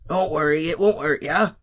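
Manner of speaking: loud but gentle reassurance; patient. A narrator's reading. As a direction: soothe a scared pupil. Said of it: "said Hagrid patiently".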